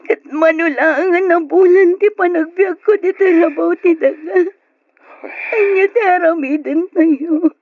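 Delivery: loud, high crying; despairing wail. Acted. Daughter asking father what they will do, sobbing loudly.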